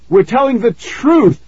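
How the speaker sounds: high, loud desperate shout. A narrator's reading. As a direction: shout the truth out, scared. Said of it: "Nicky shouted".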